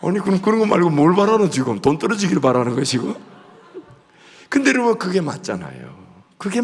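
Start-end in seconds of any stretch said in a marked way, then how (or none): 0.1-3.2 fast, loud mock-incredulous comeback; mock-incredulous teasing reply; teasing retort. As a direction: needle her playfully. Pastor's retort to the woman.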